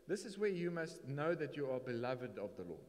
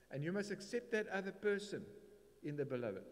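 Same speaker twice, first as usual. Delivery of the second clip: ordinary delivery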